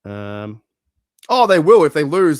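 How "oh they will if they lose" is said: sudden excited outburst. Real, not acted. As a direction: voice jumps up loud and high. Fans will flip, excited claim.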